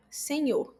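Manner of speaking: ordinary talk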